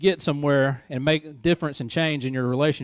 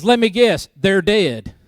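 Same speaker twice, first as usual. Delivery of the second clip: flat, louder guess, higher; a dry, louder let-me-guess; deadpan wife; 3 semitones higher, 7 dB louder, slower than usual. Retold or imitated. Wife answering her mother on the phone, dry and louder. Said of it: "And Stephanie goes".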